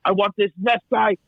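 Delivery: high, ranting mob boss delivery; De Niro as Capone. Acted. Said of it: "There's such amazing delivery there".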